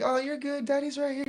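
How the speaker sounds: high, soothing daddy voice; retold reassurance. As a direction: reassure a frightened little girl. Dad reassuring frightened daughter, retold.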